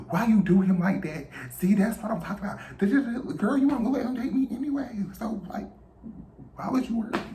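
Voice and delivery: mock fussing grandma; grandma scolding. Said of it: "my grandma was like".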